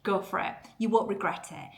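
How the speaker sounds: ordinary delivery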